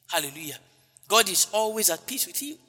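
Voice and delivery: normal talking voice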